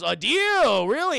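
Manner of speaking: loud, high-swooping incredulous retort; mock incredulity. Kingpin answering Hammerhead's offer of a deal, mock incredulous. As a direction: act out a crime boss's loud disbelief.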